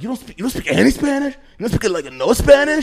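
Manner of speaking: high, loud incredulous relatives; mocking relatives. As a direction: scoff at him for not speaking Spanish. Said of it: "they were like".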